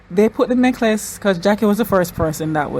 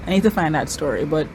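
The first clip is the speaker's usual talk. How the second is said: ordinary talk, nothing marked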